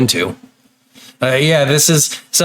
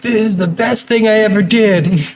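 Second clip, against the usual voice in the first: higher-pitched mock-excited self-quote; retold delight; 8 semitones higher than usual. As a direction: proudly act out being thrilled with a purchase. Retells delight at his purchase.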